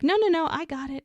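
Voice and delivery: quick, high retold protest; eager insistence. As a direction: rush in to take over, pitch up. Cameron hastily insists on helping.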